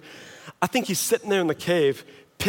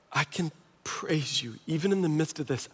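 whisper; 3 semitones lower, 10 dB quieter than usual. Retold or imitated. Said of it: "just a whisper".

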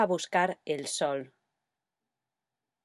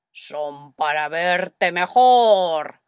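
slow, louder, sly wolf; the big bad wolf drawing it out loudly; 7 dB louder, much slower than usual. Wolf answering Caperucita, slow and loud. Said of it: "¿Qué dice el lobo?".

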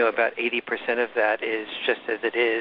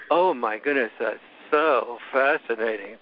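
slow, unimpressed sarcastic imitation; mock boredom. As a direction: drag it out as if utterly uninterested. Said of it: "Another time she'll, she'd go".

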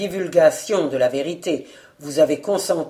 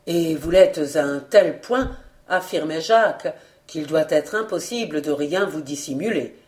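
usual speaking voice

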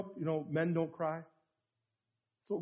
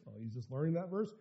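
jaded dismissal; 3 semitones lower, 9 dB quieter than usual. Retold or imitated. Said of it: "somebody sits next to them, been saved thirty years".

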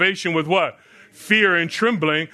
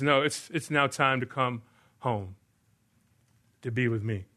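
soft, lowered voice of the Lord; tender calling; 4 semitones lower, 7 dB quieter than usual. Retold or imitated. Preacher voicing the Lord calling him home, soft and tender. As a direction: gently call someone home.

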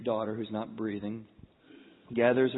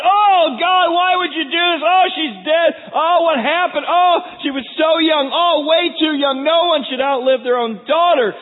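hammed-up high, shouted lament; loud wailing; 14 dB louder than usual. Mourners over a dead daughter, loud acted wailing. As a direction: wail it out loud in mock grief. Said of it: "those who wept and wailed out loud".

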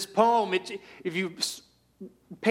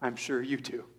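dry, softer and lower, fading; answering his mom's "I love you more", teasingly soft; teasing reply; 6 semitones lower, 5 dB quieter than usual. Retold or imitated. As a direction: tease mom, dropping to a murmur. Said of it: "I say".

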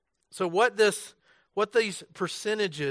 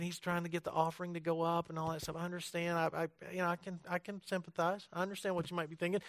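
soft aside; 12 dB quieter, faster than usual. Real, not acted. Quiet aside about offering suspicion.

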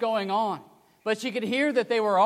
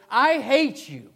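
loud, slow, higher cry of hate; angry yell; 5 semitones higher, 6 dB louder, slower than usual. Retold or imitated. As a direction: hurl it at him. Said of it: "she yelled as loud as she could".

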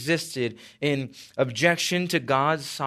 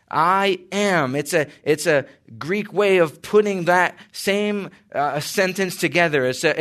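preaching at full force; 3 semitones higher, 3 dB louder than usual. Acted.

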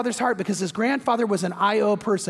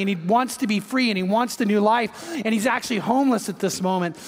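normal talking voice

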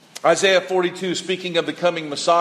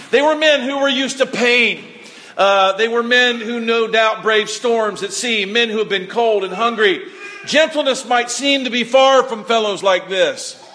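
loud, high, building sermon voice; full-force pulpit delivery; impassioned preaching; 5 semitones higher, 4 dB louder than usual. Acted. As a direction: preach it with fire to the congregation.

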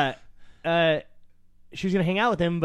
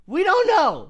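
loud, high-pitched insistence; real exasperated exclamation; emphatic outburst; 11 semitones higher, 8 dB louder, slower than usual. Real, not acted. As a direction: burst out with it, voice jumping up. Insists she might be Russian.

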